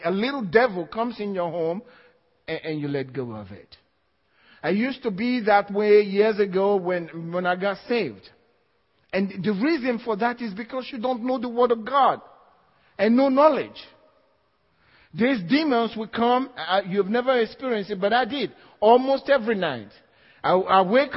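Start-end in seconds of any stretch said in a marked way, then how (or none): none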